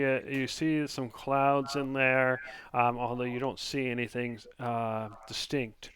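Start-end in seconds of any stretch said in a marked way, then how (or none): none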